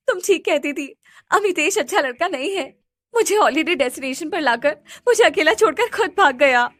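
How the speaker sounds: abandoned sister crying; crying on phone. A narrator's reading. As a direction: sob it down the phone. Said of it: "रिया की रोने की आवाज आती है".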